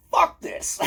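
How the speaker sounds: very high-pitched mock outburst; retold defiant outburst. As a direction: squeal it in comic refusal. Acted defiant refusal while waiting.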